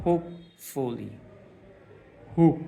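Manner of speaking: teacher's demo stressing "হোপ" in "hopefully". Shown as a demonstration. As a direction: press on "হোপ", then pause. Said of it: "এখানে একটা চাপ হয়".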